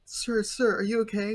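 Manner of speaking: high, urgent calling to someone hurt; acted anxious questioning; mock concerned passer-by. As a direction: check on him with put-on alarm. Passer-by checking on man in pain.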